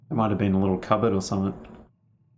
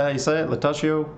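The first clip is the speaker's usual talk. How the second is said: higher-pitched, questioning Italian accent; 6 semitones higher, faster than usual. Shown as a demonstration. Streamer checking how to say a word, Italian accent. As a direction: try the word out as an Italian would. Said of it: "saying it in an Italian accent".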